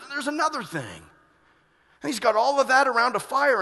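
loud fervent sermon peak; fiery preaching. Acted. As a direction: preach it hard to the congregation. Preacher builds to full force.

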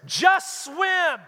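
high, loud yelled "Just swim"; sarcastic mock shout. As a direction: bark it from the dock at someone drowning. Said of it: "Or do we just go".

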